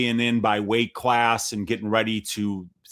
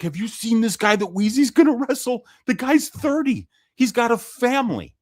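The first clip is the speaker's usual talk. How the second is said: much higher mocking ball-buster voice; joking buddy; 10 semitones higher than usual. Buddy Dave teasing me about the grown man, mock high voice. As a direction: ham up a teasing friend's jab.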